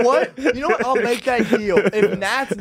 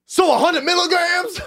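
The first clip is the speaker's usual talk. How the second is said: high, sassy mimicry of a friend; sassy Kevin voice; 11 semitones higher than usual. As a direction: imitate him with high-pitched sass. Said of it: "say in the Kevin voice, and so sassy".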